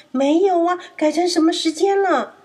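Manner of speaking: urgent, high little lamb; anxious lamb. Acted. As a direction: lamb afraid to miss the party. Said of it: "羊咩咩好着急：".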